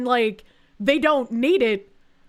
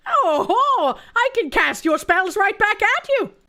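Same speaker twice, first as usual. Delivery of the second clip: playful wizard taunt; 6 semitones higher than usual. Acted. Joke spell-casting retort.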